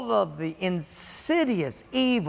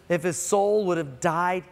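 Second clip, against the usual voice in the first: ordinary talk, nothing marked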